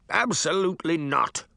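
ordinary delivery, nothing marked

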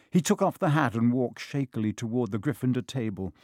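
usual speaking voice

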